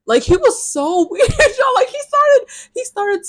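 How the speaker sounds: high, loud, laughing squeal; laughing disbelief. Real, not acted. Laughing at how weird he was.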